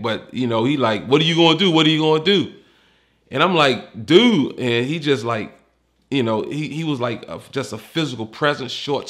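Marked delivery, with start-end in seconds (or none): 1.1-2.5 louder, higher taunting challenge. Retold or imitated. Stocky man taunting the narrator, repeated loud dare. 4.0-4.6 louder, higher exasperated "Dude."; raised protest. Retold or imitated. Facing the man in his face, loud exasperated protest.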